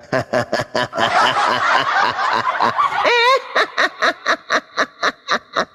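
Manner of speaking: long wicked villain cackle; evil laugh. Pretend. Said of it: "Boleh dong, saya ketawa jahat".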